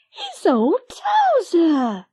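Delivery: high, slow, joyful exclamation; excited. Acted. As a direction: drag it out with delight. Lizzie overjoyed at the dog.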